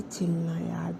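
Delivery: soft unvoiced whisper; whispering to soothe an angry friend; whispered calming. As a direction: whisper it to calm her down. Calming upset friend quietly.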